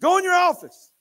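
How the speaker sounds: put-on high, loud little-girl command; bossy toddler. Granddaughter orders Papa to his office, high and loud. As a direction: order him off like a tiny boss.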